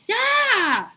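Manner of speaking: piercing high scream, very loud; girl shrieks when her pigtail is yanked. A narrator's reading. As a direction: let out a shrieking yell of pain. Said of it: "screamed Leslie".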